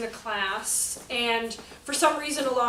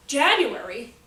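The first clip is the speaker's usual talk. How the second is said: usual speaking voice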